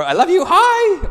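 high, loud mock adoring greeting; gushing lovestruck hi. Shown as a demonstration. Pastor acting a lovestruck texted hi, high gushing. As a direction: ham up a smitten hello.

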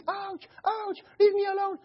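high mock cries of pain; fake screaming. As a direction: fake the scream while grinning. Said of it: "smiling and screaming".

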